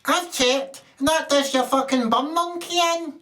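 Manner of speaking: put-on high, piping little girl voice; a small defiant Scottish girl speaking up; wee girl voice. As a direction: play a tiny indignant girl. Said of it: "a wee tiny girl says".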